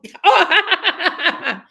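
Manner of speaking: loud, high burst of laughter; open, high-pitched laugh; big laugh. Real, not acted. Laughs after her own joke.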